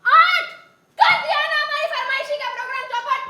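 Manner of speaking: high, loud outburst of complaint; loud dismay. Acted. Woman realising her radio programme is missed, loud dismay. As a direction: cry out in exasperated alarm.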